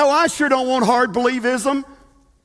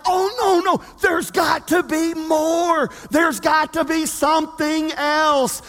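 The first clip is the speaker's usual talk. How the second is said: hammed-up no, there has to be more; mock protesting believer; 3 semitones higher than usual. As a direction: ham up the doubter's objection. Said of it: "we're like".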